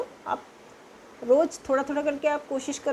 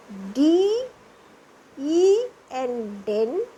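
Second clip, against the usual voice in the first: each letter drawn out, then the word; slow spelling; much slower than usual. Shown as a demonstration. Teacher drilling a-sound words, spelling slowly. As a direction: spell slowly so pupils can follow. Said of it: "और ई से क्या उच्चारण होता है?".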